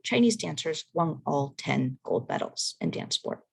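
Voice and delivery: ordinary delivery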